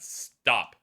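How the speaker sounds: sharp shout. Acted. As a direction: yell it out to stop them. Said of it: "when he shouted".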